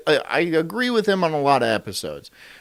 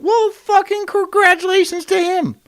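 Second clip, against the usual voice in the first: high mocking sarcasm, much higher pitch; sarcastic congratulations; 14 semitones higher, 4 dB louder than usual. Podcaster sneering at a Twitter arguer, sarcastic.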